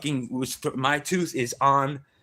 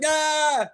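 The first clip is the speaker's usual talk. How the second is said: mock startled yelp; 3 dB louder than usual. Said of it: "I'm like".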